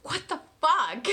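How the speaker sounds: exasperated laugh; laughing disbelief. Retold or imitated. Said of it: "it was like".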